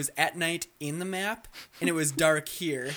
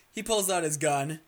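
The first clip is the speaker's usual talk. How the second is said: ordinary talk, nothing marked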